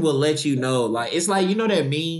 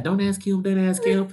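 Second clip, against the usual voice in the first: higher-pitched husband, lightly voiced; husband's aside, imitated; 7 semitones higher than usual. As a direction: imitate a husband interjecting from the side. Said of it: "her husband was, like, sitting to the side".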